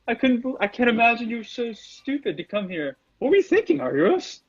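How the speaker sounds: high-pitched villain in gleeful disbelief; surprised villain. Acted. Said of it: "there's a look of surprise on his face".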